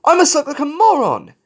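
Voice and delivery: high, loud self-reproach; fretful high complaint; embarrassed wail. A narrator's reading. Falcon wailing, ignoring shouts.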